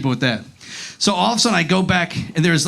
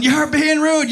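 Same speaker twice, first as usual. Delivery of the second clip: mock yell; 8 semitones higher, slower than usual. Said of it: "they're yelling".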